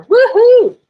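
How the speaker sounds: very high, loud triumphant woohoo; cheer. Real, not acted. Teacher whooping when the file finally opens.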